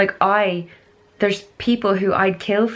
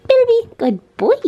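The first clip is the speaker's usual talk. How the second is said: very high, slow, louder praise for the dog; 6 dB louder than usual. Real, not acted. Praising her dog Bilby, very high slow pet voice. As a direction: squeal praise at the puppy.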